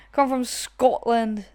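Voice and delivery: slow, rolling Scottish line; Scottish accent. Retold or imitated. Attempting Scotland in a Scottish accent. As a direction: drag it out Scottish-style.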